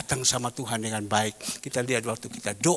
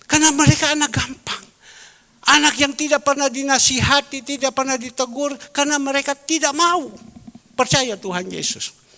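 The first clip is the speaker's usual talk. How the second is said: loud, higher preacher's lament; preaching at full force; 11 semitones higher, 7 dB louder than usual. Acted. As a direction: hammer it home to the church. Loud preaching over music.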